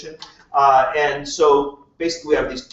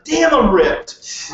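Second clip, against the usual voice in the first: slow, higher, louder show-off; mock boast; 7 semitones higher, 6 dB louder, much slower than usual. The speaker mocks a one-time gym goer, slow and smug. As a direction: stretch out the smug brag.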